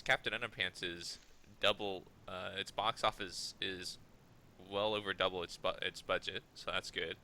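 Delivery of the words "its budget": ordinary talk, nothing marked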